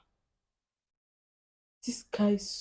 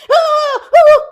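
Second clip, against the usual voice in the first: high, loud startled yell; mock shout; 16 dB louder than usual. Mimicking loud Chinese talk, high startled yells. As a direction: yell out as if scared.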